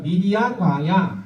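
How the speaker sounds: put-on said slowly for kids to copy; slow model phrase. Shown as a demonstration. Pastor leading children to repeat, slow and clear. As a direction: spell it out slowly so they can repeat. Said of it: "따라해볼까요?".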